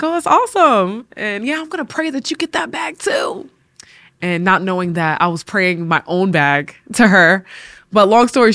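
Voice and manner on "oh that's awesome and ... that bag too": sweetly humoring. Retold or imitated. Humoring mom again.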